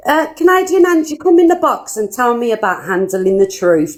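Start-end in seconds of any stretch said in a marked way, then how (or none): 0.1-2.0 raised, loud call-out of a name; calling her out. Real, not acted. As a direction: summon her angrily into the box. Angrily calling out a rival.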